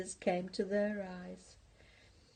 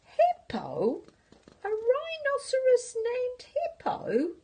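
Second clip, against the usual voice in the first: pitched way up in disbelief; incredulous squeal; 5 dB louder than usual. Acted. Porcupine hearing the rhino's name, disbelieving high squeal.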